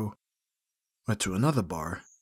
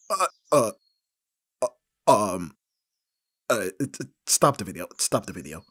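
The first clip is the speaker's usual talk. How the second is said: stammering louder plea to stop; flustered panic; 5 dB louder than usual. Acted. Begging the friend to stop the embarrassing video, flustered. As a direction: scramble to stop it, mortified.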